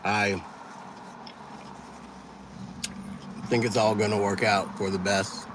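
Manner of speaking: talking while chewing food; mouth full. Real, not acted. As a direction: speak through a mouthful of food. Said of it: "I'm eating, chewing with my mouth full".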